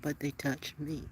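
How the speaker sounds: put-on soft, slow, awed echo of the toddler's words; tender quiet repeat; slow hushed repeat. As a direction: let the line land, barely above a breath. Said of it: "how she looked in those words".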